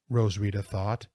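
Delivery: plain reading voice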